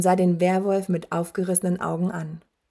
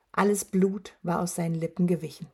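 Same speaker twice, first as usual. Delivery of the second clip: ordinary delivery, nothing marked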